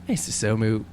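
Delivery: ordinary talk, nothing marked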